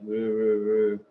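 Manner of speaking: a low, hollow "woo, woo, woo"; low hooting imitation. Streamer mimics the man's muffled voice. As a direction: hoot it like a far-off murmur. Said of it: "his voice is like".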